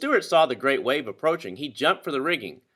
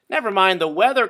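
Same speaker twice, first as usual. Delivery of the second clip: louder, higher call; cried out; 6 semitones higher, 6 dB louder than usual. A narrator's reading. Owner shouting back from shore, loud higher cry. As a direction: brush off the worry with a shout. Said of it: "cried the owner".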